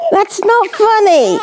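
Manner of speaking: high, laughing and slow, half-scolding. Real, not acted. Mother to her baby at feeding time, laughing. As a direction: giggle through a mock protest.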